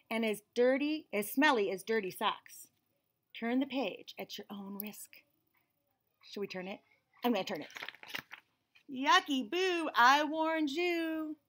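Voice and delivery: put-on lively storybook reading in a child's narrating voice; picture book read-aloud. A narrator's reading. Reading a picture book to children, lively and bright. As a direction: read it like the kid telling the story.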